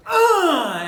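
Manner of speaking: high, loud mock rock scream; imitated scream. Fan imitating the singer's scream in Freedom, loud. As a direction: belt out the singer's yell. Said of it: "has lots of".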